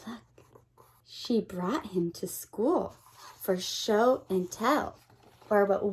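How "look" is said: usual speaking voice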